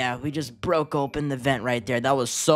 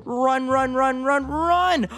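high, rising play-panic squeal; panicked urging; 10 semitones higher, slower than usual. Pretend. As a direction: urge them to flee, pitch climbing. Fleeing a danger in the game.

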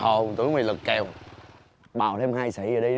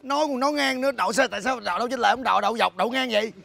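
high-pitched rattled-off reproach; indignant scolding; 12 semitones higher than usual. Acted. Man blaming vendor for parking, indignant scolding.

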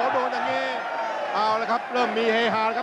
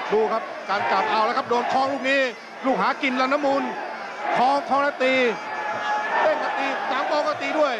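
raised-pitch live fight call; excited boxing commentary; 4 semitones higher than usual. Acted. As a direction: call the clinch fast and eager.

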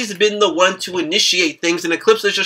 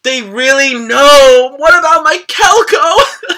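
slow, high wail of dismay; loud, drawn-out comic lament; mock despair; 5 semitones higher, 4 dB louder, slower than usual. Fan wailing over the Kelko breakup, mock despair.

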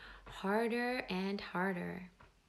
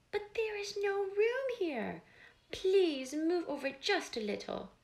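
squeaky reply; 9 semitones higher, 4 dB louder than usual. Acted. Answering the sparrow.

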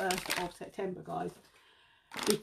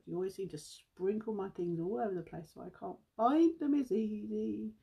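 ordinary talk, nothing marked

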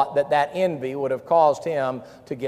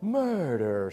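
TV murder-show voice; 6 dB quieter than usual. Retold or imitated. Preacher naming those murder shows, drawn-out mimicry. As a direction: stretch the word out for suspense. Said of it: "That's how they say it on the shows".

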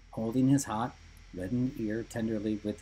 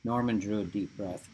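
plain reading voice

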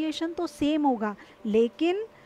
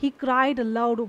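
he cried aloud, stretched slowly; slowly, for learners; slow example; 3 semitones lower, 3 dB louder, much slower than usual. Shown as a demonstration. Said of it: "ज़ोर से". (धीमे उदाहरण)

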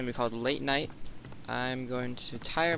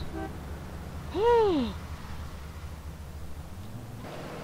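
gasp; 4 dB quieter than usual. Real, not acted. Car runs over gang.

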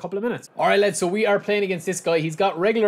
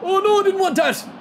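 high, loud cry of dismay; 7 dB louder than usual. Real, not acted. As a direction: wail it like the chance just went wrong. Conceding a chance in match.

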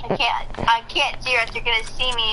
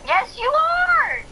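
slow high insistence; much slower than usual. Real, not acted. Annoyed at friend shooting.

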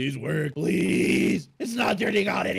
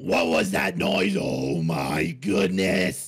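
angry dad shout; 12 semitones lower, slower than usual. Acted. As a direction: bellow at the noise like an angry dad. Dad hears TV crash.

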